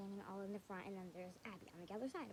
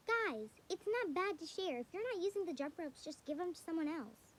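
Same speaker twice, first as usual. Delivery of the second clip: high, louder, preachy teacher voice; teacher lecturing; 7 semitones higher, 6 dB louder than usual. Retold or imitated. Teacher lecturing the class on sharing, high and preachy. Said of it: "she was like".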